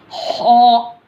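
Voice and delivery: हो said thick from the upper throat, high and held; thick throat sound. Shown as a demonstration. Teacher showing a heavy letter, हो said thick and high. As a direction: make हो heavy, from the throat end nearest the mouth. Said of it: "मोटा करके पढ़ना है".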